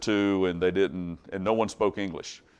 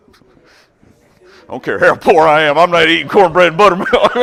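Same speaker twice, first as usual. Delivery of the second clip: loud, high comic protest; exaggerated holler of refusal; retold refusal; 6 semitones higher, 13 dB louder than usual. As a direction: blurt it out in hammed-up disgust. Quotes self refusing poor food.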